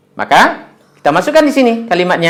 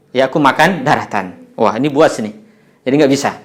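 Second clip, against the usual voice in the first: normal talking voice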